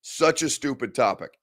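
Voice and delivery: ordinary talk, nothing marked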